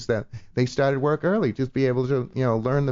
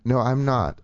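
normal talking voice

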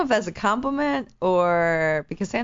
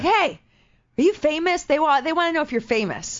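tour driver calling over; 7 semitones higher than usual. Retold or imitated. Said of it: "He's like".

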